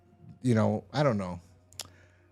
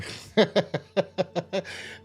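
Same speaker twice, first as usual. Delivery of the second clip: high-pitched run of chuckles; laughing; 8 semitones higher than usual. Real, not acted. Recapper amused by the botched mission, laughing. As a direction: crack up in a long, high chuckle.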